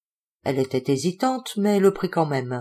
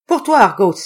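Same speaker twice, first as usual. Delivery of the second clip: louder call to the dog; 3 semitones higher, 7 dB louder than usual. Acted. Narrator calling to Argos, louder. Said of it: "criai-je".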